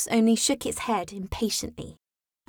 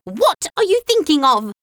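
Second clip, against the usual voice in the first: higher, sharp scolding question; severe; 6 semitones higher, 4 dB louder than usual. A narrator's reading. The mouse scolding inattentive Alice, severe. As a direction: demand it like a cross teacher. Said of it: "said the mouse to Alice severely".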